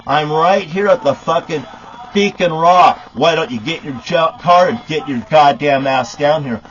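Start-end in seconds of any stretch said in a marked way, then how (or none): none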